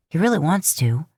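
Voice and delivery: ordinary delivery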